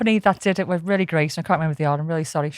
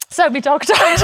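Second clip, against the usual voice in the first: laughing; 6 dB louder than usual. Real, not acted. Cracking up retelling dog's death.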